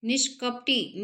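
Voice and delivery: usual speaking voice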